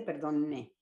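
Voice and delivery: ordinary talk, nothing marked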